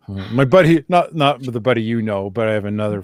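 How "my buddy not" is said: normal talking voice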